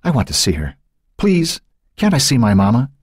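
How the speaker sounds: put-on childlike begging, rising; pleading. A narrator's reading. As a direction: beg to see her. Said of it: "spoke to him with a pleading tone".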